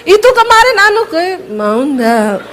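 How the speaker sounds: comic try at Javanese downward tune on "mau ndak"; attempted falling ending. Shown as a demonstration. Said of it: "Berhari-hari saya mencoba".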